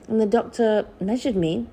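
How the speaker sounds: ordinary delivery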